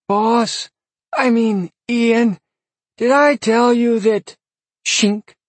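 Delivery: high breathy little demon; adoring squeaky imp; whiny demon voice. A narrator's reading. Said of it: "breathy, whiny voice".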